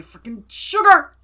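exasperated outburst, loud at the end; mock scolding. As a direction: punch it out in playful outrage.

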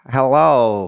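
exaggerated mock American accent. Mocking idea of eighteenth-century English sounding American, hammed-up American accent. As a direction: ham up an American greeting. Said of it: "in the eighteenth century in England, everyone was like".

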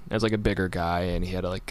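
usual speaking voice

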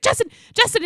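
high, loud call of his name; urgent calling out. Retold or imitated. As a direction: call him back, pleading. Wife calling husband to stop.

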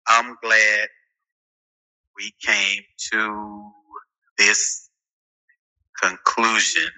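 slow halting word-by-word reading; sounding out words. Shown as a demonstration. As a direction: read it haltingly, one word at a time. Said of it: "This how it sound".

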